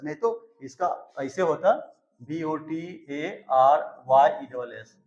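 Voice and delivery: usual speaking voice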